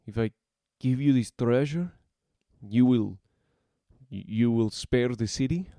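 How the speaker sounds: halting with fear; nervous man forcing out the words; stumbling, hesitant. Acted. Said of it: "stumble over his words".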